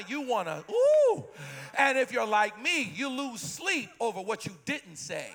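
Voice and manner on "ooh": sudden high squeal of feeling; high ooh. Real, not acted. Preacher catching the feeling, high ooh.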